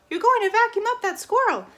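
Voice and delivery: shout. Acted. Flora replaying her own shout, alarmed. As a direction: belt the warning. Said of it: "you would shout".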